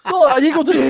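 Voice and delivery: ordinary talk, nothing marked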